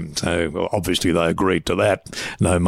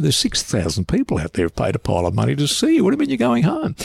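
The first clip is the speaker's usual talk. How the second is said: retold disbelief, climbing high at the end; mock-incredulous rise; incredulous protest; 7 semitones higher than usual. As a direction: protest as if replaying an argument. Retold argument with reluctant star.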